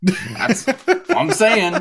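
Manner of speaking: high, slow, emphatic agreement. Real, not acted. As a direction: lean hard into the agreement.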